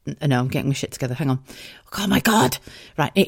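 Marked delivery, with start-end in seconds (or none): none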